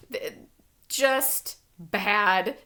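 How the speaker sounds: ordinary talk, nothing marked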